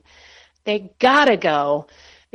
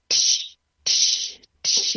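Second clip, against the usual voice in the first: tear drip noise; much slower than usual. Retold or imitated. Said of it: "You can hear it all over my page".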